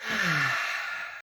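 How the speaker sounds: long breathy exhale; drawn-out, falling sigh; releasing sigh. Shown as a demonstration. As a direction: let the tension out in a slow sigh. Releasing breath after tapping demonstration.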